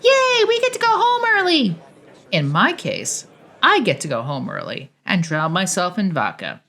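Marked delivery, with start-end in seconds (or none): 0.0-1.8 put-on very high, loud childish squeal; four-year-old screaming. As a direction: shriek like an excited little kid. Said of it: "a four-year-old that screams".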